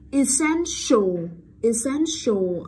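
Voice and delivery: essential drawn out slowly, long sh before the ending. Shown as a demonstration. Teacher drills the class on sh, essential slow with long sh. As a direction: model "essential" with a lingering SH. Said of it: "sh dài đúng không".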